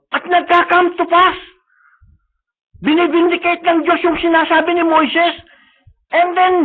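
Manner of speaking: high, loud, impassioned sermon; preaching at full force. Acted. Preacher urging the church to claim God's promise, impassioned. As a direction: rouse the congregation with fervour.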